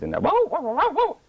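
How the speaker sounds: high mock crowd whooping; crowd cheering. College crowd cheering the comeback, high mock whoops. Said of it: "let me play back what you just said".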